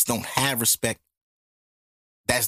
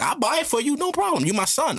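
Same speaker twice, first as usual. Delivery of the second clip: mom voice; 11 semitones higher than usual. Retold or imitated.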